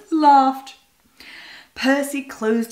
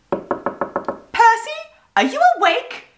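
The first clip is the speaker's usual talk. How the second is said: high, light bird voice calling; a chirpy bird waking her neighbour; high little magpie voice asking; 13 semitones higher than usual. A narrator's reading. Miss Pye voiced, high bird.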